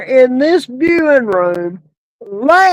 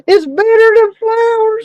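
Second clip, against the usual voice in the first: laughing; 8 semitones higher than usual. Real, not acted. Joke delivered while laughing.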